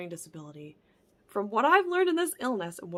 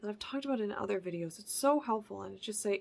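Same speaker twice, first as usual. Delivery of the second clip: normal talking voice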